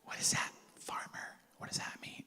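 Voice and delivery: hushed, wondering whisper; whispered question to God. Retold or imitated. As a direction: ask it under your breath. Asking God in prayer.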